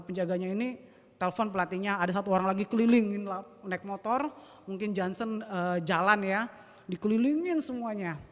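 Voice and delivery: ordinary talk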